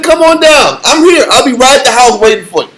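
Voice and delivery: high, loud retold taunt; mock-eager invitation; taunting dare. Retells daring the scam caller.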